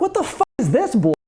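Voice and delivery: high-pitched indignant disbelief; retold outrage. Dad reacting to the bishop's overreach, indignant. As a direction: burst out incredulous, pitched well up.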